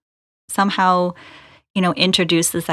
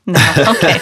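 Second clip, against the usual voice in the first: usual speaking voice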